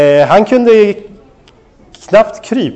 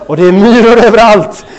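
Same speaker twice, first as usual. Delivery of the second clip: loud, high comic exclamation; storyteller's mock-panicked outburst; retold alarm; 5 semitones higher, 6 dB louder than usual. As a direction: burst out louder and higher, reliving the horror. Retells discovering ants all over baby.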